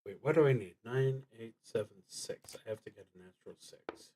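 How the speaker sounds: ordinary talk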